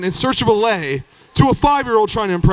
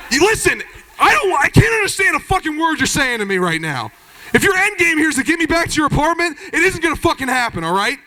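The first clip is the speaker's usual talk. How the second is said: higher, exasperated shouting bro; mock angry bro; 6 semitones higher than usual. Annoyed bro rejecting Jeff's apartment offer, mock angry. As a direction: tell the creep off, half laughing. Bro angrily rebuffs Jeff.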